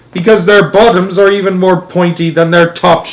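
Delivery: loud, high explanation; shouting. A narrator's reading. Astronaut answering the president, shouting. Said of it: "shouted Shuckworth".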